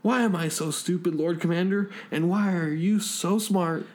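high, whimpering character voice; shivering, terrified crewman. Acted. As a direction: wail in self-reproach, pitched high. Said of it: "shivering and screaming".

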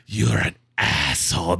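low, very slow put-on insult; fed-up scam caller. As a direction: imitate an offended caller, drawing out each word.

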